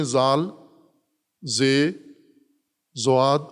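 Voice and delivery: زال، زے، زواد named one by one, each set apart with a pause; Arabic letter names. Shown as a demonstration. Teacher listing Arabic letters زال، زے، زواد for students, carefully sounded. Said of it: "لیکن عربی میں آپ دیکھیں".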